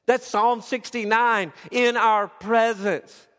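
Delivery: preaching to the congregation, lifted and loud; full-force preaching. Acted. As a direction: proclaim the Messiah with fervor.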